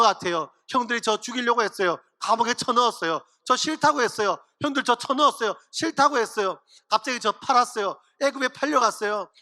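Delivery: mock foreign-worker accent in Korean, choppy short sentences; foreigner's broken Korean. Joseph testifies at an Egyptian church, mock foreigner accent in Korean. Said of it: "제가 요셉이라면 이렇게 간증하겠어요, 말도 안 통하는 해외에서".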